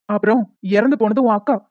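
ordinary talk, nothing marked

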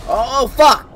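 high, loud burst of alarm; startled curse. Real, not acted. Player startled by danger underwater, startled curse. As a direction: swear loudly as if caught out.

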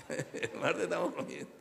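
chuckling as he says it again; laughing. Retold or imitated. Preacher laughing at his own retold boast. Retelling own confident words.

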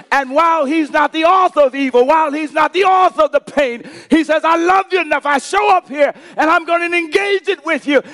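high, loud, impassioned sermon shout; full-force preaching. Real, not acted. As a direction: preach it at the top of your voice. Preaching climax of the story.

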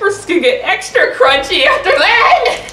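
words through laughter; laughing. Real, not acted. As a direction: laugh while joking. Joking while laughing.

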